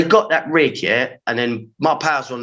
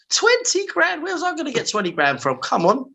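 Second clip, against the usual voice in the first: much higher, disbelieving outrage; twenty grand, are you joking; incredulous; 13 semitones higher than usual. Retold or imitated. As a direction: scoff at the ridiculous fine. Outraged at the court's fine.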